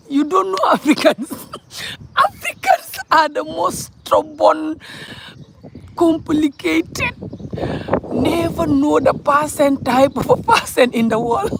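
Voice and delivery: high, drawn-out words through laughter; laughing-crying. Real, not acted. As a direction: talk through a real laughing fit. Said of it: "I'm laughing, but I'm crying".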